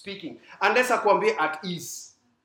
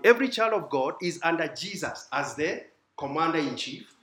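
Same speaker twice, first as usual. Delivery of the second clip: ordinary delivery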